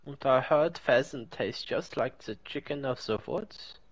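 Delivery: put-on German accent. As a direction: chat in a playful German accent. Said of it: "It's very clearly a German accent".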